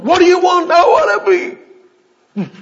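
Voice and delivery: very high, very loud shout; imitated crying out. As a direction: yell it out like a desperate plea. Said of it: "Jesus called that same cry out".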